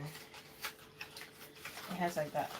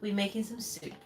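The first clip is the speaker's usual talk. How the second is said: normal talking voice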